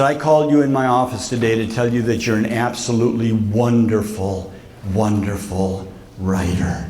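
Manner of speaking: low, gentle retold praise, slowing at the end; sincere praise. Professor tells the student he writes wonderfully, low sincere praise.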